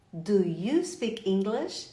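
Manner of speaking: drawn-out question, word by word; slow, carefully spaced question; slow teaching model. Shown as a demonstration. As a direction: say "Do you speak English" slowly for learners. Teacher models phrase slowly, then naturally.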